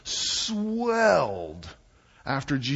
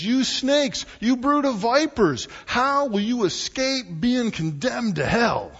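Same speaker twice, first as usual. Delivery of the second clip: ranting rebuke; 7 semitones higher than usual. Retold or imitated. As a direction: thunder it at the hypocrites. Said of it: "a great Jesus rant".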